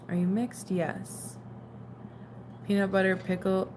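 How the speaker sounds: ordinary delivery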